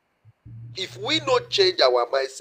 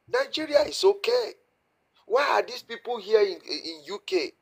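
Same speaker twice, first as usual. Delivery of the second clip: much higher mock-innocent official voice; mocking the leader; 6 semitones higher than usual. Panelist mocks the leader talking abroad, in a high parody voice. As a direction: parody a leader brushing off the problem. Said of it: "your leader go outside there and tell the people".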